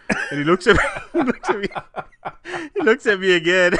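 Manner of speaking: high, loud, laughing storyteller; cracking up. Real, not acted. Host telling co-host about the intern at the sheetrock, cracking up. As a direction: struggle to get it out through laughter.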